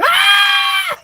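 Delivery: piercing very high shriek; hammed-up loud falsetto scream. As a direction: let out a shrill comic scream. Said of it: "And he screamed".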